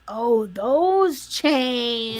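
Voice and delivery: Oh, those chains stretched very slow; slow drawn-out take. Shown as a demonstration. Repeating her own line in a slow drawn-out voice. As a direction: drag out those chains slowly.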